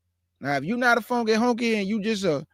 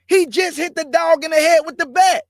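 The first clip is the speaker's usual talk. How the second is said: put-on higher, louder yell at a kid to stop; alarmed stranger hollering for the mom; outraged bystander shout; 8 semitones higher, 6 dB louder than usual. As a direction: shout across the yard to stop him. Bystander yelling back at mom.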